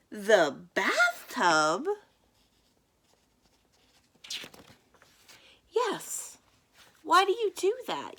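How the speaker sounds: lively picture book read-aloud; reading to children. A narrator's reading. Librarian reading the bathtub dinner story to kids. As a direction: play the two boys' back-and-forth for young listeners.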